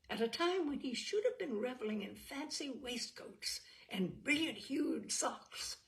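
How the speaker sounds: ordinary delivery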